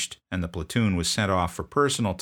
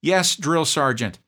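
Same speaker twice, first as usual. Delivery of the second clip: much higher, slow, loud recruit answer; proper reply; 10 semitones higher, 8 dB louder, slower than usual. Retold or imitated. Private answering correctly to his sergeant, high and slow. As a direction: sound off like a recruit.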